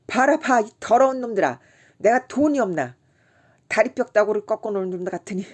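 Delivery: high, loud enraged yelling; angrier yelling. A narrator's reading. Kim raging at everyone in the tavern, loud. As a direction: rage at them all. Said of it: "더욱 성을 내며".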